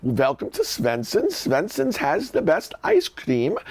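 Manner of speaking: slow Swedish greeting with a little bounce; bouncy Swedish accent. Shown as a demonstration. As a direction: demonstrate the bouncy Swedish welcome. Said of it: "You have to do a little bounce".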